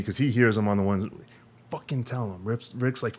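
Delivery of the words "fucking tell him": much softer, barely voiced urging; mouthed. Boss Rick silently urging his employee to speak. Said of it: "mouths to me".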